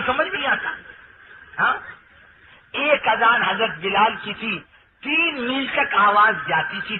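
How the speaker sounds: normal talking voice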